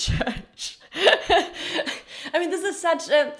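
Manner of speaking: high, quick, still laughing. Real, not acted. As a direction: push the words out through real laughter. Still laughing about sheep in church.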